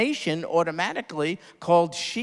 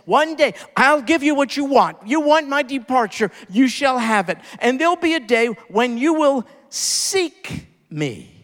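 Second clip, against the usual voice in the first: forceful declaration; 7 semitones higher, 5 dB louder than usual. Acted. Preacher paraphrases Jesus forcefully.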